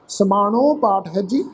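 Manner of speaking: ordinary talk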